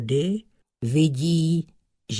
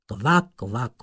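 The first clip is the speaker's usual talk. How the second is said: plain reading voice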